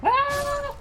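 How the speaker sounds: loud high-pitched falling scream. Real, not acted. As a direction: shriek as you drop. Falls to death.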